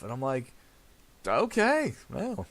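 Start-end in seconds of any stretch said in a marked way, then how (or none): 1.3-2.5 high, startled okay; mock taken aback. Son reacting to father walking off, taken aback. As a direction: act caught off guard. Son's deflated reaction retold.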